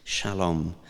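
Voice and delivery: Shalom said softly and lingeringly, sh-a-LOM; lovingly savouring the Hebrew word Shalom; savoured shalom. Shown as a demonstration. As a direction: let Shalom roll out gently with a soft sh. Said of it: "It sounds lovely".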